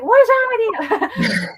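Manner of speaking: high, loud, drawn-out mock shriek at her husband; reenacting yelling at her husband about fishing, loud high exasperation; exasperated yell. Mock-yelling at husband in retold argument.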